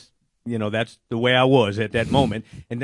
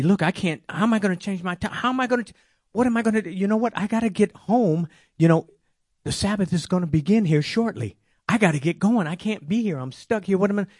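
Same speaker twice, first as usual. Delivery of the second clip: retold panic, voice pitched way up and rushing; fretting aloud, higher and faster, stuck by the roadside; getting upset; 8 semitones higher than usual. Stranded before the Sabbath, telling how he fretted aloud, pitched up and rushed. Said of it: "starting to get a little upset".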